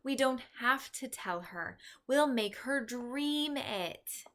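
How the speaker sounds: put-on slow, conspiratorial eager girl; lightly excited girl. A narrator's reading. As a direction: share a secret plan, thrilled. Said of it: "Sophie said excitedly".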